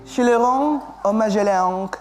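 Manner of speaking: high, French-sounding place names; French accent. Shown as a demonstration. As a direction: say it like a French chef. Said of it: "disebutkan dengan logat bahasa Prancis".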